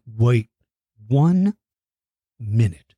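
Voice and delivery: ordinary talk, nothing marked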